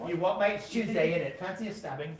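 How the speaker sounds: put-on playful British accent. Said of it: "you have to speak in a British accent".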